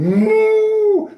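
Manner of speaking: high, loud cow moo. A narrator's reading. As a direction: burst out mooing. Animals chase the farmer.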